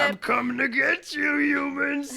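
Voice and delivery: high, loud monster threat; sandworm monster. Acted. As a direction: roar it like a giant worm. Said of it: "says the gigantic sandworm".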